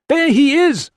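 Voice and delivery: very high, full-force cry; loud yell. A narrator's reading. Struggling man pointing out the boy to attackers, yelling. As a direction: yell it out urgently over the crowd. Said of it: "he yelled".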